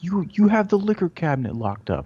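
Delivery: high, indignant customer; angry customer. Retold or imitated. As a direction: protest being treated like a thief. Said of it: "It's like".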